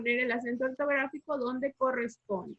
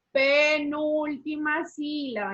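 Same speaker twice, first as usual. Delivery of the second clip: "Penúltima sílaba" stretched out slowly, syllable by syllable; emphatic answer; 2 semitones higher, slower than usual. Shown as a demonstration. Teacher giving the class the answer, "Penúltima sílaba" slow and emphatic.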